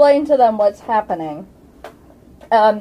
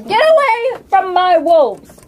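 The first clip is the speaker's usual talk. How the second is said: loud orc warning, louder than usual; gruff orc; 9 semitones higher, 5 dB louder than usual. Acted. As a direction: bark it like a threatening orc. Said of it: "The orc says".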